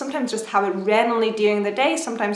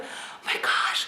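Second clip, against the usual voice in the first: hushed, whispered amazement; retold awe; 7 dB quieter than usual. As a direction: re-enact a soft, airy gasp of wonder. Awed first sight of baby.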